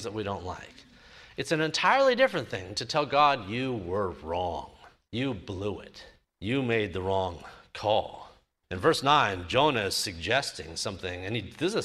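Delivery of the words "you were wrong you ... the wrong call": cold accusation. Retold or imitated. Jonah accusing God.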